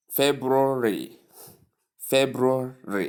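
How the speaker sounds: "February" slowly as FEB-roo-ree; correct pronunciation. Shown as a demonstration. Teacher introduces word number five, slow careful pronunciation. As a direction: sound the R after the B in "February", drawn out. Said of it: "You pronounce the R sound".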